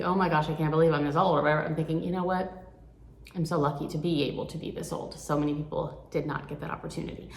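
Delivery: ordinary talk, nothing marked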